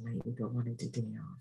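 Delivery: very soft, low confession; small, embarrassed voice; sheepish admission. Retold or imitated. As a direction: quietly own up. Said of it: "I said".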